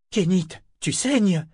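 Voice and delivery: high, slow horrified exclamation; gasp-like alarm at an injury, drawn out. A narrator's reading. Eta sees Kénit is bleeding, horrified. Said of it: "s'exclama-t-elle horrifiée".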